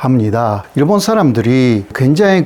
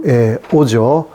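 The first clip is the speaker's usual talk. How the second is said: normal talking voice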